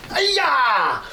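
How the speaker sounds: high, sharp martial arts yell; high kiai cry, "Ay-ya!"; karate kiai shout. Pretend. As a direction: yell it like a movie karate kick. Demonstrating karate kid shout.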